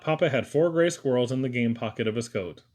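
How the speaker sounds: ordinary delivery, nothing marked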